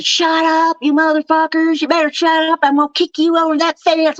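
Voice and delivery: high-pitched mock yell at the dogs; retold screaming. Woman re-enacting screaming at her barking dogs, mock yell. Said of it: "screaming at the top of my lungs".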